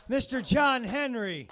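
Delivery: loud, high, drawn-out name call; announcer shout. Real, not acted. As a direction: belt out the comic's name for applause. Host calls out departing comic.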